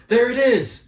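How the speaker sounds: high, very loud mock shout; imitated scream. Imitating Toph looking for the library, imitated scream. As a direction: scream it out as a put-on announcement. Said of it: "she screams out".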